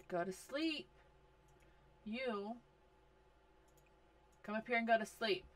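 ordinary talk

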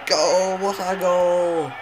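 drawn-out goal celebration shout; long goal yell. Real, not acted. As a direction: roar it, stretching the goal.

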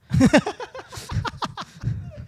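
a high squeal of a laugh. Real, not acted. Laughing after agreeing.

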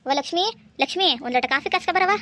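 loud call to the kitchen. Acted. Mother-in-law calling Lakshmi in the kitchen for coffee, loud call. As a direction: call her from the next room.

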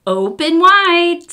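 high, loud, slow doctor voice; doctor saying open wide. A narrator's reading. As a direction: stretch it out like a doctor with a light. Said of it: "said the doctor".

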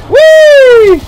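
high loud whee; gleeful squeal. Real, not acted. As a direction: squeal with glee like on a ride. Jumping through portal.

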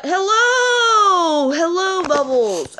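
long, high sing-song hello; high, slow, drawn-out greeting. Real, not acted. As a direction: stretch out a delighted greeting. Greeting an animal in-game.

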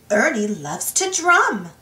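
plain reading voice

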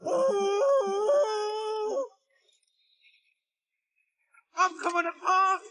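very high, squealing wail. A narrator's reading. Scruffy coming apart on the rails, wailing high. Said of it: "wailed Scruffy".

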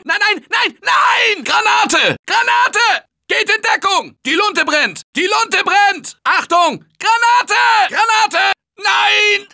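high, frantic repeated cries; terrified alarm; panicked shouting. Acted. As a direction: yell a desperate grenade warning. Grenade panic.

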